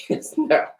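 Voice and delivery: breathy laugh through the words; real laugh, high and airy. Real, not acted. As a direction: giggle the yes and no out. Laughs at own slowness.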